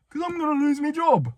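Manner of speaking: very high-pitched panicky outburst; retold panic. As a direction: let the pitch leap up in exaggerated panic. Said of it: "I'm panicking".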